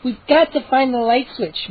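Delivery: ordinary delivery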